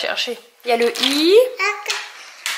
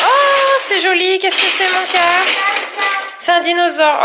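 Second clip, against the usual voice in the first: delighted praise; 6 semitones higher, 4 dB louder, slower than usual. Real, not acted. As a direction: gush over the toy, drawing it out. Mother praising child's Duplo creation.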